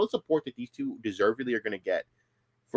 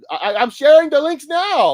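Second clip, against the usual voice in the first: higher, loud burst of excitement; blurting it out, worked up; real excitement spilling over; excited; 12 semitones higher, 11 dB louder than usual. Real, not acted. Excited outburst while sharing.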